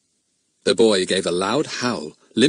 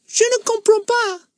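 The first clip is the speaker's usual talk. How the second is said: put-on silly, much higher boy's voice, twisting his cap. A narrator's reading. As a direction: pipe it up like a silly schoolboy. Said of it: "in a rather silly, high voice".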